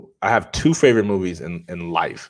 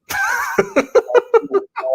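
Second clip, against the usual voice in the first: laugh. Real, not acted. Laughs at own hibachi craving.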